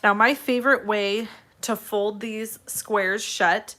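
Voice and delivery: normal talking voice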